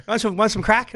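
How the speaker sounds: friendly Irish lad. Retold or imitated. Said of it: "He goes".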